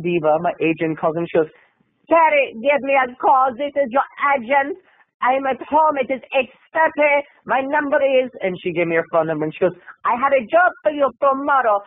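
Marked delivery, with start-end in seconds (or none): 2.1-8.3 German diva. Acted. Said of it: "the German diva". 10.0-11.8 high-pitched, badly faked German accent. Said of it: "it's not very good German".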